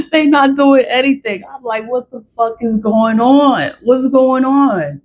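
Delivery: high-pitched, worked-up venting; exasperated disbelief. Real, not acted. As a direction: exclaim it high, at your wits' end. Worked-up rant, laughing in disbelief.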